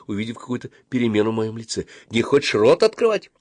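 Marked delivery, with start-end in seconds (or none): 2.1-3.3 higher, mildly alarmed question; frightened. A narrator's reading. Dentist noticing the boy's changed face, slightly frightened. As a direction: ask with a touch of fright. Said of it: "испуганно спросила врачиха".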